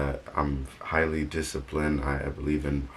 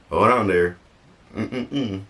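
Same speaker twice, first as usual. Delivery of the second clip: mock stern halt; 6 semitones higher, 6 dB louder, slower than usual. God stops you, voiced.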